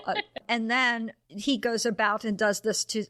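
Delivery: ordinary talk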